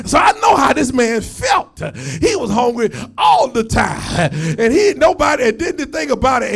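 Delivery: loud, driving pulpit shout; fired-up preacher belting it out; full-force preaching. Real, not acted. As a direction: preach it hard, punching the key words.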